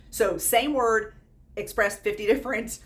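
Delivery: usual speaking voice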